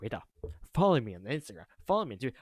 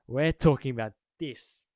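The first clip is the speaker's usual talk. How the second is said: ordinary talk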